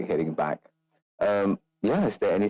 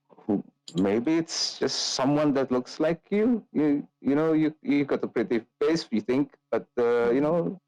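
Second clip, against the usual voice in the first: lightly voiced teasing brother; higher pitch, halting brotherly needling; Ismark; 8 semitones higher than usual. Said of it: "Ismark just goes".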